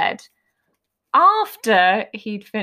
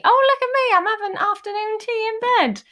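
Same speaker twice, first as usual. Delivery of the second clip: much higher, mock-grand delight; posh mock voice; 12 semitones higher than usual. Quoting her husband in bed with a grand high voice. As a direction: lightly lord it over the tray like royalty. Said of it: "That's how that came out".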